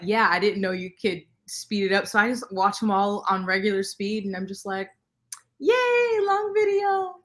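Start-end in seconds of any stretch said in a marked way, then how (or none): 5.6-7.2 high, drawn-out singsong yay; mock cheer. Viewer greeting another long video, mock cheer. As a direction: cheer it in a high, sustained little voice.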